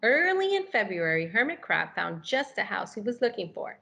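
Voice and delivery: animated picture-book narration; reading to children. A narrator's reading. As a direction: read it like it's story time for little kids.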